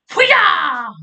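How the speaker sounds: karate cry. Retold or imitated.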